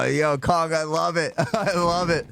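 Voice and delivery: usual speaking voice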